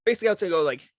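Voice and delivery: ordinary delivery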